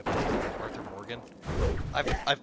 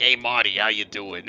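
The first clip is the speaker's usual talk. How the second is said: loud put-on old lady greeting; loud grandmother voice; 10 dB louder than usual. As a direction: belt it like a cartoon grandma. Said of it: "She's like".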